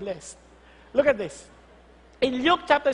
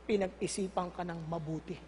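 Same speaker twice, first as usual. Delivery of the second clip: tender; 8 semitones lower, 9 dB quieter than usual. Shown as a demonstration. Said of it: "Sabi sa katabi mo".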